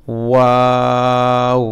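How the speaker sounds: ওয়াও drawn out slowly with rounded lips; rounded-lip waw. Shown as a demonstration. Teacher showing waw with rounded lips. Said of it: "ঠোঁট গুল করতে হবে".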